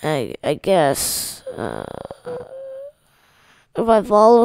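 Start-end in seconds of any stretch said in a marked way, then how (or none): 0.0-1.8 tired voice. Real, not acted. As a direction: drag it out like you're exhausted. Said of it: "it's my tired voice".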